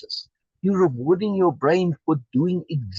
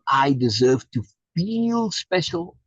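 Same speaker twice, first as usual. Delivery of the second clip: slow, drawn out and stretched; mock self-important quote; 3 semitones higher, slower than usual. Teacher quoting the self-important idea, slow and drawn. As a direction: lean on feel special as a foolish claim.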